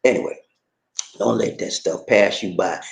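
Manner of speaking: ordinary talk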